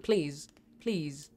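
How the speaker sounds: low, soft repeated begging; small, hushed plea; quiet pleading. Real, not acted. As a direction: plead under your breath, very low. Quiet repeated pleading while scared.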